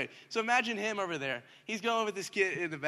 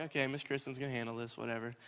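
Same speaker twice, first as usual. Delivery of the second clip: dismissive shrug; 5 semitones lower, 8 dB quieter than usual. Retold or imitated. Bored kid brushing off the lesson.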